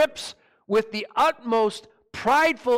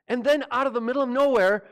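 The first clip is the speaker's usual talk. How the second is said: ordinary talk, nothing marked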